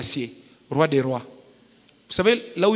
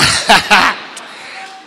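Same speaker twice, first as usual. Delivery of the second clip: a big unvoiced laughing burst; loud burst of laughter; 8 dB louder than usual. Real, not acted. Laughing hard as his wife catches him out. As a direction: laugh out loud, caught red-handed.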